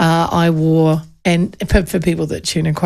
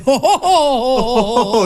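mock hearty "ho ho ho"; imitated crowd reaction; 4 semitones higher than usual. Room of lawyers reacting to MC's joke, mock uproarious ho-ho. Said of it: "to which they all went".

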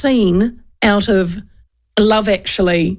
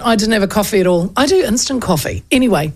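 ordinary talk

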